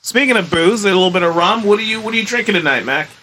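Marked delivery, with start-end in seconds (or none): none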